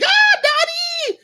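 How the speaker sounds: high, long drawn-out squeal of greeting; joyful scream. Retold or imitated. Daughter running out to greet her father, screaming. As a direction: scream it out high, stretching the cry.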